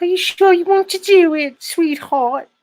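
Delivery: very high, hammed-up female English accent; squeaky My Fair Lady parody, English accent; mock English wife. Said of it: "make your wife sound like ... Audrey Hepburn".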